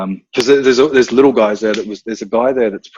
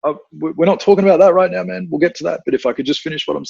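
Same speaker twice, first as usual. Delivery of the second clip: brushing off a know-it-all; 7 semitones higher, faster than usual. Retold or imitated. Retelling himself cutting off student.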